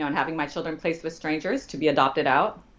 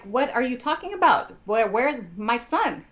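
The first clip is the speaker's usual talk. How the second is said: high-pitched, stretched-out disbelieving questions; incredulous demand; 7 semitones higher than usual. Retold or imitated. Client to lawyer about her son, incredulous and high. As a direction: demand answers in a raised voice.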